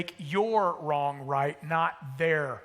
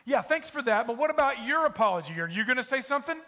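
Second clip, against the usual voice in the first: indignant back-and-forth, pitch high, getting louder; escalating marital argument; 8 semitones higher, 3 dB louder than usual. Acted. Spouses bickering over who should apologize, increasingly indignant. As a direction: build from testy to loudly outraged. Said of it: "erupts into an argument".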